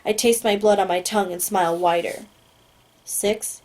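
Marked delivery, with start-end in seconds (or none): 3.0-3.6 soft, coaxing single word; gentler voice. Acted. Agent switching to good cop with the prisoner, gentle and softer. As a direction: ease off, play the good cop. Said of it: "the man asks in a gentler voice".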